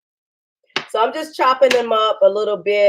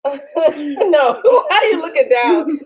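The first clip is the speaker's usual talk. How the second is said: laughing through the words, pitched up; amused laughing; 4 semitones higher, slower than usual. Real, not acted. Mother correcting daughter on camera, laughing. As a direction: protest through giggles.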